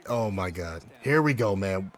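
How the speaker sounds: normal talking voice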